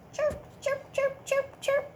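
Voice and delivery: very high chirping; cricket chirp. Shown as a demonstration. Teacher imitating a cricket for children. And what's that sound?.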